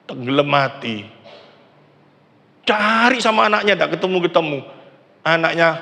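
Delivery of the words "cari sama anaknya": normal talking voice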